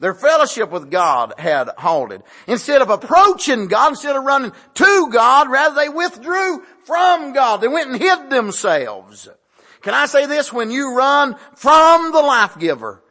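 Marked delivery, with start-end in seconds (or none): 2.5-9.3 high, loud shouted climax; preacher's shout. Real, not acted. 9.8-13.0 loud exhortation. Real, not acted. Preacher exhorts.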